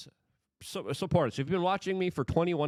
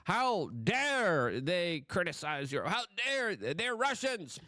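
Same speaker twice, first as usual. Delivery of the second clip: higher, slowed-down mocking pearl-clutch; media's fake outrage, mocked in a higher voice; 7 semitones higher, slower than usual. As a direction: parody the media's shocked gasp. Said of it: "are just freaking out and are saying".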